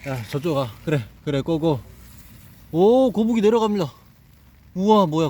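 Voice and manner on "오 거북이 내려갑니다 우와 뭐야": high, loud delighted call; excited commentary. Real, not acted. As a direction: cheer it on as it slides. Turtle slides down.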